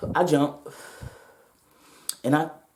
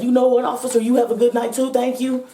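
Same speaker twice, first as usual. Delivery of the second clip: relieved gushing thanks; 7 semitones higher than usual. Retold or imitated. Thanking the officer who let her go, relieved and eager. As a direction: gush it with relief.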